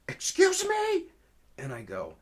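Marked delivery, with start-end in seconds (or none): 0.1-1.0 very high, loud, falling at the end; shocked indignation. Retold or imitated. Officer stunned by the caller's rant, shocked indignation. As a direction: imitate an officer aghast, voice shooting up.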